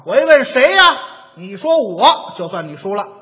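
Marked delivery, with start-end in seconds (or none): 0.1-1.0 loud call of who's there. Shown as a demonstration. Showing how he'll answer a knock: loud call. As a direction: call out toward the door.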